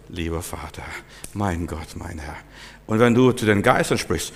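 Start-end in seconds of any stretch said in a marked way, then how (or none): 0.1-2.4 low, hushed appeal to God; soft pleading prayer. Shown as a demonstration. Preacher showing how one asks God for something, hushed and reverent. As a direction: pray very softly and low, as if asking a favour. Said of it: "wenn du von Gott was haben möchtest".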